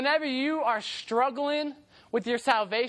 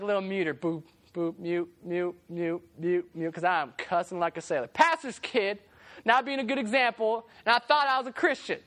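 usual speaking voice

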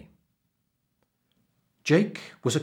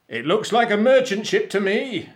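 loud, high pirate shout; captain's shout; 11 semitones higher, 9 dB louder than usual. A narrator's reading. Captain spots a ship in the mist, shouting. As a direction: call it out across the ship. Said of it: "he shouted".

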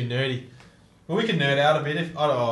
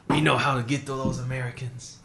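badly faked accent, landing near Mexican; botched Mexican accent. Terrorist scheming against Americans, badly faked Mexican accent. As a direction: joke villain in an accidental Mexican accent. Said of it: "That's just like a Mexican accent".

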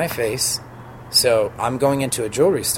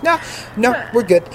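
bright dismissal; 12 semitones higher, 4 dB louder than usual. Retold or imitated. Brushing off the staff's concern.

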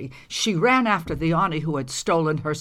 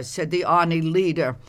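usual speaking voice

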